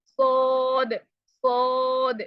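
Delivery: सोद pushed from the throat, the mistake; wrong throaty saad. Shown as a demonstration. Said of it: "ये मैं नीचे से आवाज़ निकाल रही हूँ". (गले से निकाला गलत सोद)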